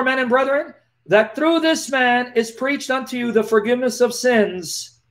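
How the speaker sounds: normal talking voice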